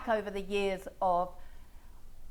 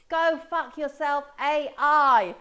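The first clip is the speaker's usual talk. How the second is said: slow, loud mock shout; retold shout; 6 semitones higher, 6 dB louder, slower than usual. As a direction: yell it out with the crowd. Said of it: "he all shouted".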